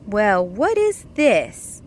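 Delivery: lightly voiced little duck wondering; a hint of a puzzled duckling; curious little duck.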